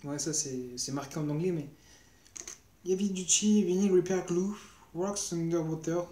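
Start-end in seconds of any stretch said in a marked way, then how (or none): 2.9-6.0 English accent. Shown as a demonstration. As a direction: read the English label with a hammed-up English accent. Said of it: "mon accent anglais magistral". (lecture avec un accent anglais exagéré)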